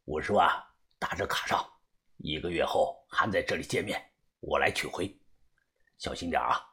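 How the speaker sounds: very low, quiet secret reply; low-voiced go-between, keeping it discreet; middle-aged contact man. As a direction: answer the password in a deep hushed voice.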